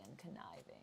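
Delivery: hushed, low, gravelly schemer; scheming villain's low, rough murmur; gravelly and conniving. Shown as a demonstration. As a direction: drop to a sly, gravelly near-whisper. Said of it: "Mephisto I always think of as".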